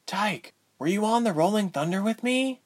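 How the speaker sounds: high, tentative question; quiet heroine. A narrator's reading. Ilse to Tyke through bars, high quiet question. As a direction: ask high-pitched, without raising the volume. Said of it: "Ilse asked quietly".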